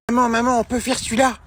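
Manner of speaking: high, loud begging; excited child pleading. Acted. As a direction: beg mum eagerly for the ride. Child begs for a ride.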